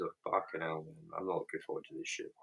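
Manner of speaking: usual speaking voice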